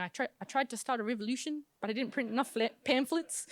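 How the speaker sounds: lilting, badly faked New Zealand accent; mild-mannered mock Kiwi; terrible Kiwi accent. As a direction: imitate a movie character with a clumsy Kiwi accent. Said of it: "that's a terrible new Kiwi accent".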